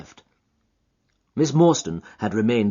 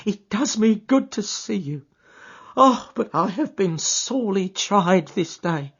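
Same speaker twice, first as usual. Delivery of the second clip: put-on high, shaken old woman; an upset old servant unburdening herself; distressed housekeeper; 11 semitones higher, 4 dB louder than usual. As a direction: pour out her relief.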